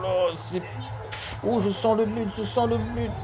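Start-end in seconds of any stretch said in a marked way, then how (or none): 1.5-3.1 excited. Acted. Commentator senses a goal coming.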